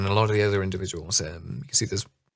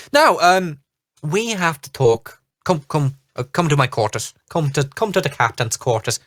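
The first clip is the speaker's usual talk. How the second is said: captain voice; 4 semitones higher than usual. Acted. Captain summoning the party.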